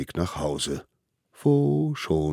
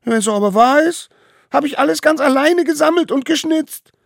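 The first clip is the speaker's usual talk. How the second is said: put-on very high, very loud child's tantrum; defiant shriek; 13 dB louder than usual. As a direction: shriek your claim in protest. Smallest one shouts his claim. (trotzig und gellend geschrien)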